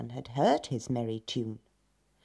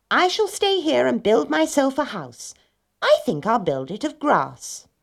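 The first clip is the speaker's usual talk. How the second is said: high, louder little pig voice; piggy character voice, pitched up; 9 semitones higher, 6 dB louder than usual. Acted. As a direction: play a little pig announcing his plan.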